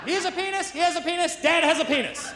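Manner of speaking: put-on high, accusing little girl announcing "has"; accusatory little girl. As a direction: point and accuse like a toddler. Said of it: "very accusatory way".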